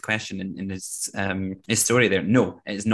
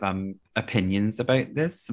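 usual speaking voice

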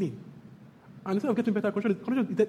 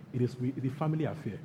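ordinary delivery

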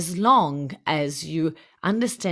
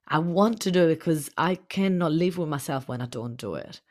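usual speaking voice

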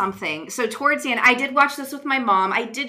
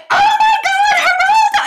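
huge high-pitched shriek of recognition; hammed-up, over-loud imitation of an excited mom; exaggerated mom's yell; 10 dB louder than usual. Mom catching a Geraldo joke in a movie, exaggerated yell. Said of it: "she didn't do it that loud".

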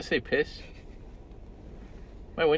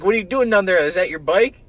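retold yell; 9 semitones higher, 12 dB louder than usual. Yelling at kid from car.

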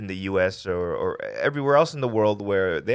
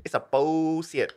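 mock Vietnamese accent; 7 semitones higher than usual. Joking about the computer, mock Vietnamese accent. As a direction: say it high in a put-on Vietnamese accent. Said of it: "in the words of my Vietnamese friends".

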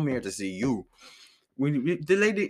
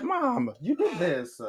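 accusing; 8 semitones higher, much slower than usual. Retold or imitated. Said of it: "I held her accountable".

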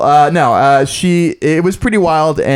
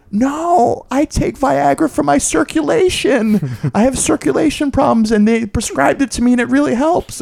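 much higher, lightly voiced mother; mother calmly explaining her prescription; mom explaining; 11 semitones higher than usual. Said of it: "And she was like".

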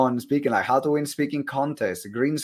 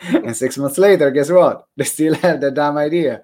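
loud, raised comic exasperation; mock-exasperated punchline; 4 semitones higher, 8 dB louder than usual. Host joking about people stuck on ideas, mock-exasperated. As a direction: hit the repeat hard and higher.

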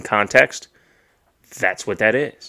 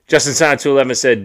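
ordinary talk, nothing marked

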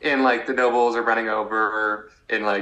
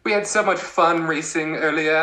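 childish mock Edmund; 9 semitones higher than usual. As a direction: play the naive boy-king teasing his rival, laughing through it. Acting out kid king Edmund.

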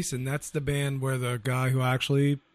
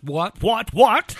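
each What higher and louder; rising disbelief; 8 semitones higher, 9 dB louder, much slower than usual. Real, not acted. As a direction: build from surprise to a shriek. Reacting to [name] tickets.

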